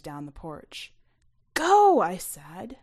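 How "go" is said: sharp, high, loud order; urgent shout. Acted. Narrator urging her friend to run. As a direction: push him away fast.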